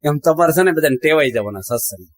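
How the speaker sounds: usual speaking voice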